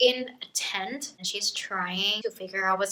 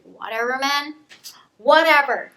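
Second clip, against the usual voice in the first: dismissive, rising to a loud final whatever; eye-roll whatever; 4 semitones higher, 8 dB louder than usual. Retold or imitated. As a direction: roll your eyes and shrug it off. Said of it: "rolls her eyes and is like".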